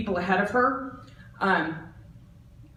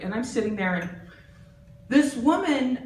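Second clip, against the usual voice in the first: normal talking voice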